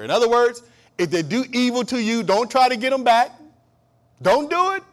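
loud, high, driving sermon voice; full-force preaching. Acted. Preacher rousing the congregation about God's power, loud preaching. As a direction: preach it hard to the back pews.

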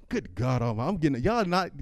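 ordinary talk

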